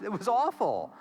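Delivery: ordinary talk, nothing marked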